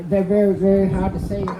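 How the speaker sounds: ordinary talk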